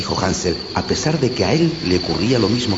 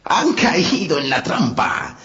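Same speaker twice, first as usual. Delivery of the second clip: slow, gloating witch; 9 semitones higher, slower than usual. Acted. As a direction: savor the trap, slow and sly. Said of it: "exclamó la bruja".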